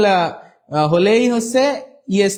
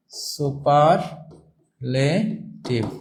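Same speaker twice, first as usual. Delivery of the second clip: slowly stretched term; 3 semitones lower, 3 dB quieter, much slower than usual. Shown as a demonstration. Teacher stretching the key term.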